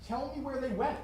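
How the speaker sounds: loud, forceful order; angry demand. Acted. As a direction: force the answer out of her. James demanding answers.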